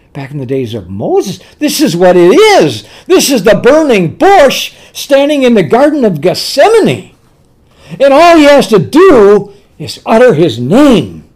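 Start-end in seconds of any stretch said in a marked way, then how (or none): 1.6-11.2 loud, raised-pitch sermon climax; forceful preaching. Real, not acted. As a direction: drive the revelation home with full force.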